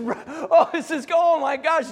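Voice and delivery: giddy with wonder; laughing joy. Acted. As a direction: laugh through it, high and bright with delight. Peter's joy walking on water.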